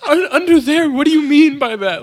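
high, loud panicked confusion; panic, voice jumping up; freaking out. Retold or imitated. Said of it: "He's freaking out".

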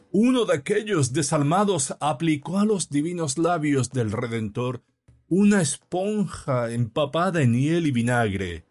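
high, declamatory pulpit voice; preacher. A narrator's reading. Priest telling his flock of the Passion, high declamation. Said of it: "seguía el orador".

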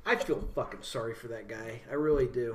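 low, soft mock sympathy; sarcastic. Real, not acted. Host on a blacked-out fan, low-key sarcasm. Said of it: "Your sarcasm is noted".

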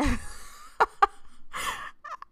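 airy squeal of a laugh, way up high; high breathless laugh. Real, not acted. Tipsy host laughing at her own dirty example, breathless squeal. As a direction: let a tipsy giggle escape in a squeak.

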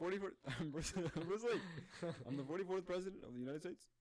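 high, laughing mock announcement; laughing impression. Acting out Barack Obama introducing himself, high and laughing. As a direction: act it out high-pitched, breaking into laughter.

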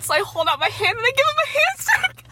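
high voice overtaken by laughter; laughing. Real, not acted. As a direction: tell it while cracking up. Cracking up telling the awkward goodbye.

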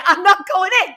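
high, loud firm refusal; emphatic refusal. Real, not acted.

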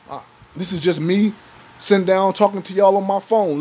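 ordinary talk

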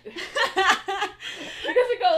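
high, loud, slow laughter; laughing hard at the scene. Real, not acted. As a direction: break into laughter.

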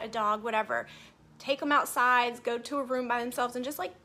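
ordinary talk, nothing marked

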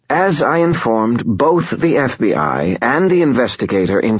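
high, clipped, wheezy lawyer; crisp, nasal legal recitation. Acted. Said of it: "Glau's voice was clipped".

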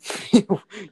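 sudden burst of high laughter; loud laugh. Real, not acted. Friend caught laughing.

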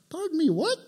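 high, startled pardon me; mock shocked reply; stunned disbelief. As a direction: caught off guard by the insult. Tax collector stunned by insult.

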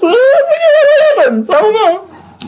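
very high, squeaky put-on voice; high comic plug voice; silly character voice. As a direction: pitch it way up into a cartoon character. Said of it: "do my plugs in a character voice".

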